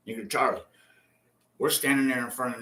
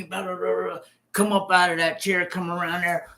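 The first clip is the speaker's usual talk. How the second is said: usual speaking voice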